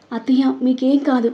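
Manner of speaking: plain reading voice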